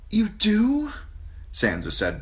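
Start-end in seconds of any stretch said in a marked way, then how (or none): 0.1-0.9 put-on high, eager girl's gasp of joy; a girl overjoyed at being loved; breathless. A narrator's reading. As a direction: Sansa breathless with delight.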